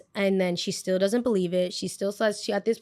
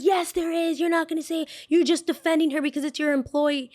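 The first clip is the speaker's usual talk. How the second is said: high-pitched mock outrage; angry contradiction; 8 semitones higher, 3 dB louder than usual. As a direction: imitate a mother indignantly arguing back. Mimicking mother arguing with the owner.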